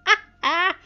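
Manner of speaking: high, loud leftover chuckle; laughing. A narrator's reading. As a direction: finish the laugh. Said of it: "laughing his head off".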